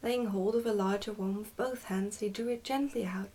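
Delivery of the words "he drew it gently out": plain reading voice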